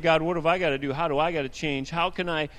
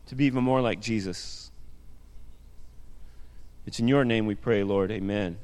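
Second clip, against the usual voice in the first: fervent pulpit prayer; earnest prayer; 3 semitones lower than usual. Acted. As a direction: pray it aloud with rising intensity. Pastor leads congregation in prayer.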